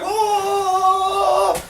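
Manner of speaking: long, high held "whoa"; imitated truck call. All the trucks answering the call, high wailing cry. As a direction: wail it out on one high note.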